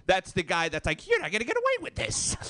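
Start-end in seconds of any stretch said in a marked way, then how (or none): 1.1-2.4 very high, cartoonish henchman threat; anime sidekick villain. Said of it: "like the second to head guy".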